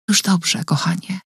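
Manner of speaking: soft, hushed comfort; whisper. A narrator's reading. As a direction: whisper it close to her ear. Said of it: "szepnęła".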